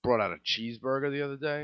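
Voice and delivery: ordinary delivery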